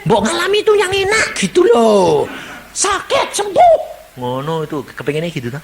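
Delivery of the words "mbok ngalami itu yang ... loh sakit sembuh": high, loud petulant plea; whiny wish. Acted. Congregant whines for only pleasant miracles, high and petulant. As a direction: wish aloud like a spoilt child. Believer wishing for nice experiences.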